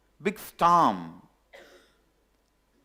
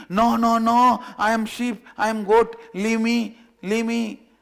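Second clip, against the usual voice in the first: high, loud frantic protest; screaming; 7 semitones higher, 6 dB louder than usual. Acted. Cub screams at the lion to be left with the sheep, loud and frantic. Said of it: "That fellow starts screaming".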